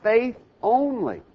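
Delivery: "only" drawn out slowly with a high pitch swing; stress on only. Shown as a demonstration. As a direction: lean slowly and hard on "only". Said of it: "Notice the emphasis on the word only".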